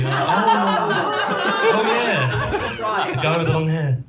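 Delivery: high, laughing oh of realisation. Retold or imitated. Retelling the Tim Burton moment, laughing high and loud. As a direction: squeal it out through laughter as the penny drops. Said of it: "retold realisation, laughing".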